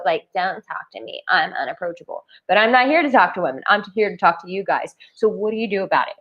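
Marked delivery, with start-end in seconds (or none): 0.4-2.2 cold brush-off. Retold or imitated. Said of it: "that bitch wall".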